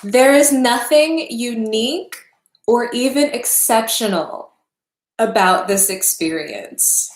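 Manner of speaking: each phrase set down heavily, like it costs something to say; halting, heavy reading. Real, not acted. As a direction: weigh every word like it hurts. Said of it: "It's hard to say".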